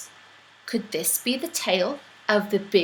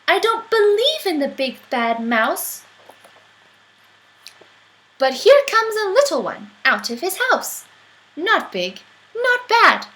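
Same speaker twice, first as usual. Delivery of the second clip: put-on higher, louder hungry child; child sizing up a meal; bold child; 5 semitones higher, 4 dB louder than usual. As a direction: gloat over a little mouse. Child threatening mouse.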